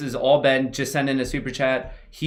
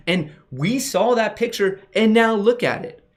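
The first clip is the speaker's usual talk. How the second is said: excited; 9 semitones higher, 3 dB louder than usual. Real, not acted. Excited reveal of market pop.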